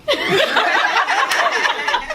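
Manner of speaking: a high burst of touched laughter. Real, not acted. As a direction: laugh out loud, moved by the praise. Emotional laugh at praise.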